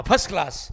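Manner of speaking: "फर्स्ट क्लास" said as "phas klaas", R and T dropped; common mispronunciation. Retold or imitated. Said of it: "होना, होना क्या जी?".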